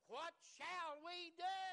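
loud, slow, desperate question; drawn-out loud plea; pleading cry. Retold or imitated. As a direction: cry it out like lost men begging for help. People cry out to Peter.

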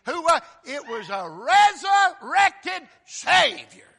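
high, loud, stretched proclamation; full-force preaching. Real, not acted. Preacher proclaiming the risen Savior to congregation, full-force preaching. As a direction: shout each word out slowly.

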